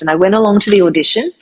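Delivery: ordinary talk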